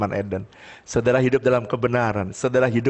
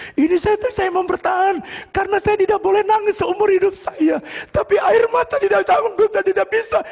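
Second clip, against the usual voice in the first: high, louder acted outburst; mock tearful breakdown; 4 dB louder than usual. Neighbour explains he can't hold back tears, overwrought. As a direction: imitate a man losing the fight against tears. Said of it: "dia menangis sejadi-jadinya".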